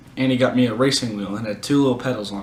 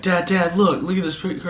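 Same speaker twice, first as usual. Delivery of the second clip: put-on higher, eager kid calling Dad; retold excitement, pitched up; excited kid; 5 semitones higher than usual. Kid shows dad a picture, excited.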